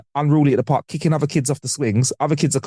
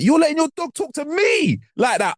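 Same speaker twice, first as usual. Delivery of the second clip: high-pitched, incredulous retort; mock indignation; 12 semitones higher than usual. Telling off a friend over their barking dog, mock indignation. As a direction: protest as if personally insulted, pitch jumping up. Acting out argument with dog owner.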